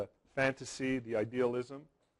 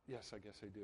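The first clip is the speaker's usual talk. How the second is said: hushed reply; 3 semitones lower, 20 dB quieter, faster than usual. Retold or imitated. Princess answers, retold.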